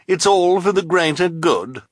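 high, loud sing-out taunt; mocking. A narrator's reading. Esme to the netted hook-handed man, mocking. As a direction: tease with loud false cheer. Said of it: "Esme replied mockingly".

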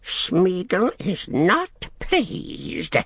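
slow, high creature whine; displeased Gollum. A narrator's reading. Gollum tells Sam his displeasure, slow high whine. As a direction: drag it out in a sulky creature voice.

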